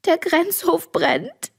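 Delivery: hoch und langsam, den Tränen nah; schluchzend. A narrator's reading. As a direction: bring es verzweifelt, fast weinend heraus. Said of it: "schluchze ich". (Mädchen gesteht den Eltern schluchzend den Brand)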